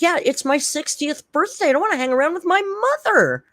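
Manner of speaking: high, whiny mock complaint. Mocking a kid whining she won't hang out. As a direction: whine it like a sulky kid. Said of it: "I said".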